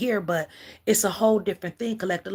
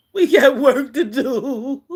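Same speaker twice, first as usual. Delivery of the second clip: laughing emphasis; 3 semitones higher, 8 dB louder, slower than usual. Real, not acted. Rallying listeners, laughing.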